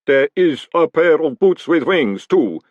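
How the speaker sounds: loud, slow golem voice; ponderous golem. A narrator's reading. Pump reporting his find to Moist, ponderous. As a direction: announce it slowly and forcefully.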